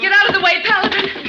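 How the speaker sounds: yelling a warning in a fight; urgent warning shout. Acted. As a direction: shout to get him clear. Frida warns Paladin.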